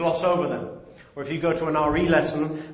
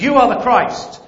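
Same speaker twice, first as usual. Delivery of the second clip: higher, louder proclamation, imitating Peter; bold, perked-up declaration; 7 semitones higher, 7 dB louder than usual. Peter answering Jesus before the other disciples, bold declaration. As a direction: pipe up boldly as the eager apostle. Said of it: "he perks up and says".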